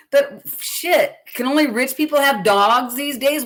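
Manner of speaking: high, loud exasperated outburst. Real, not acted. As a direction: blurt it out in disbelief. Exasperated outburst about costs.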